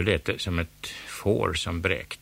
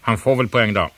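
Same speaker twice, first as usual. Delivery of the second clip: louder, grumbling concession; irritated grudging yield; grudging angry; 4 semitones higher, 9 dB louder than usual. A narrator's reading. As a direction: give in against your will. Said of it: "sa Måns ilsket".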